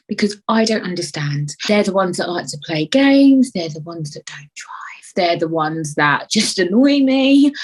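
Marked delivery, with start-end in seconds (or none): 3.5-4.8 hushed unvoiced jab; whispered aside. Real, not acted. Dropped aside.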